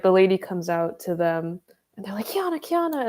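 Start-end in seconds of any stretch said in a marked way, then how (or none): 2.3-3.1 calling out. Retold or imitated. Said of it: "calling for me".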